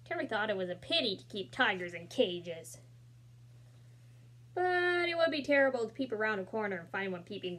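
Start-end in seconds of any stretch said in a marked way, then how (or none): none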